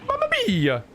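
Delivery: excitable, exaggerated comic character voice, pitch leaping high; hammed-up game character. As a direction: read it as an over-the-top cartoonish game figure.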